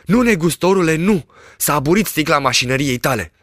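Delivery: high, protesting shepherd; lightly voiced denial; insistent shepherd. A narrator's reading. Shepherd insists the glass is fogged, protesting.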